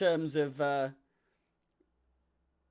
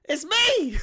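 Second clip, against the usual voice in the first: excited shout. Real, not acted. Spots himself on screen.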